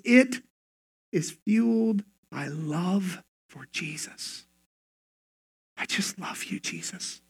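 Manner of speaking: very soft, slow, near-whisper; hushed devotion. Real, not acted. Believer telling Jesus of love, hushed devotion. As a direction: confess love almost under your breath.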